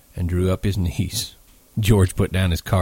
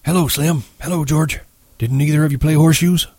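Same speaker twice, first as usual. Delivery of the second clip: put-on high-pitched old ranch hand; old-timer's high, chatty voice; old swamper; 8 semitones higher than usual. A narrator's reading. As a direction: greet them in a raised old man's voice. Old Candy greets the men.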